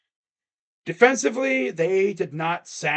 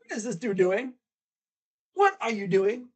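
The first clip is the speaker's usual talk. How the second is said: baffled mock disbelief, a bit higher; incredulous; 5 semitones higher than usual. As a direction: ask it like you can't believe what you're seeing. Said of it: "It's like".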